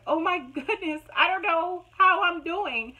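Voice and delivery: mock panic, high and loud; panicked. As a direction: retell the panic, rising and slowing. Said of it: "I was like".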